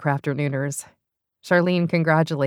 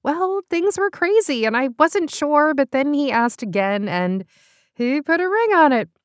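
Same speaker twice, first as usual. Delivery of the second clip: higher gushing voice; excited gossip; 10 semitones higher than usual. Acted. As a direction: gush about it. Paula excitedly recounting.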